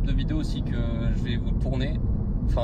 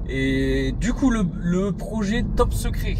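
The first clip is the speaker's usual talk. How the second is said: slow, drawn out, pitched up; dramatic reveal; 5 semitones higher, slower than usual. Acted. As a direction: build suspense on Top Secret. Suspense announcement.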